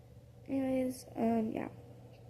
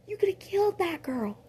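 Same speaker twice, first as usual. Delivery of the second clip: raised, scolding voice; high scolding; 9 semitones higher than usual. Retold or imitated. Bruce scolding the listener, in a raised voice. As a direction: scold her for going too far.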